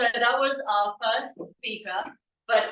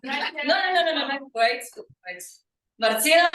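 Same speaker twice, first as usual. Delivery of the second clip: ordinary talk, nothing marked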